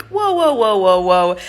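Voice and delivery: high, startled whoa to slow them down; overwhelmed whoa. Retold or imitated. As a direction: throw up the brakes, flustered. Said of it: "and I'm just like".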